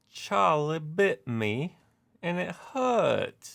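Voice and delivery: hammed-up British accent, high and slow; terrible British accent. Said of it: "I apologize for my terrible British accent".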